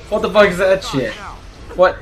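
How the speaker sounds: usual speaking voice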